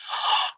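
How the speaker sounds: sharp mock gasp; quick audible intake of breath; imitated gasp. Birth mother hearing her baby cry, imitated gasp. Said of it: "And she's like".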